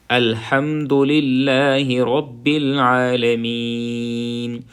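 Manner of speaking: للہ with a thin, light lam. Shown as a demonstration. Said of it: "اس کو تھوڑا سا باریک کر کے پڑھیں گے". (للہ کو باریک پڑھیں)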